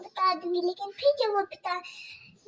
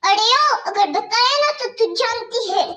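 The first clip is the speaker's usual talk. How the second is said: higher, louder mocking stepmother voice; harsh stepmother scolding; 3 semitones higher, 6 dB louder than usual. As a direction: bark orders at the girl like a cruel stepmother.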